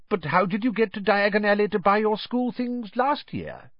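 lightly voiced curious father; a hint of an inquisitive dad; curious old wizard. Voices Mr Weasley.

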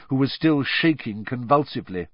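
usual speaking voice

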